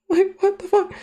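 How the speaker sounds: high squeaky disbelief; shocked what the fuck. Real, not acted. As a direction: shriek it in shock.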